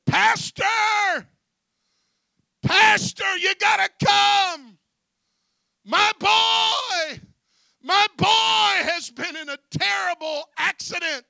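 high, full-volume desperate cry for help; imitated frantic scream. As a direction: scream it like a panicked mother on the phone. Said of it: "she was screaming at the top of her lungs".

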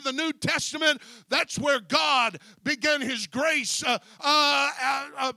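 full-force preaching. Real, not acted.

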